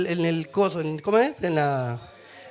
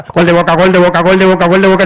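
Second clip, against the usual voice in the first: much louder chanted goal call; the lamp in a fever dream shouting goals, loud; 4 semitones lower, 13 dB louder than usual. Retold or imitated. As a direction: shout it like a football announcer at full volume.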